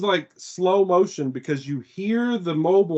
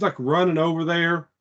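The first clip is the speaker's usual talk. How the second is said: usual speaking voice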